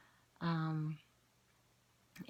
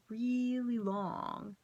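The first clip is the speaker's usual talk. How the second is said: REALLY stretched very long, pitched higher; drawn-out emphasis; 4 semitones higher, much slower than usual. Real, not acted. Telling viewers how meditation time feels, "really" drawn out slowly. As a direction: drag out "really long" to sound endless. Stretches the words to mimic length.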